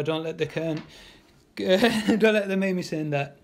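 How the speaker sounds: normal talking voice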